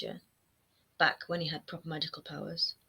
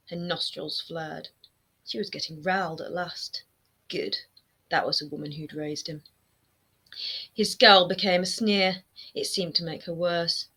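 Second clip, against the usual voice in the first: plain reading voice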